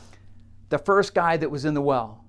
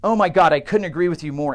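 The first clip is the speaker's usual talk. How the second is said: eager agreement; 7 dB louder, faster than usual. Acted. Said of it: "the guy says".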